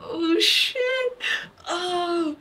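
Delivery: high-pitched, shocked exclamation; stunned. Real, not acted. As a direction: let it out high, overwhelmed. Shocked, tearful reaction while watching.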